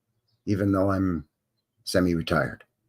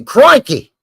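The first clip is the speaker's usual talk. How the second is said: Australian exclamation; 12 semitones higher, 8 dB louder than usual. Acted.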